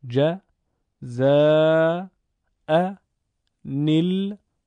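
جزاء الحسنی split into slow separate syllables. Shown as a demonstration. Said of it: "بخشخوانی رو انجام بدید".

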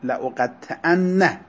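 «لأقطعن» said slowly, its final nun held long and doubled. Shown as a demonstration. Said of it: "نون تأکید ثقیله تشدید داره". («لأقطعن» با نون مشدد و کشیده)

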